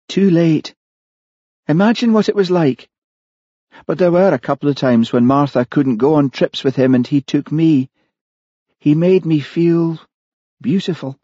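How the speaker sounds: high woman's character voice; confessing murderess, wistful. Acted. Killer tells Hamish about her lover, high wistful voice. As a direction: recall the affair in a lifted pitch.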